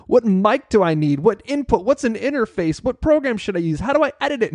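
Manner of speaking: a touch of play-acted overwhelm; mock fretting. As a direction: imitate a worried beginner, pitch climbing with each question. Retells beginner's anxious recording questions.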